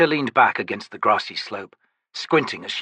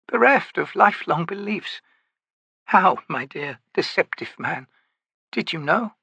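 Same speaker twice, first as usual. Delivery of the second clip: aged, dignified woman's voice, higher; old priestess voice; 6 semitones higher than usual. Acted. Maibi's inner thought.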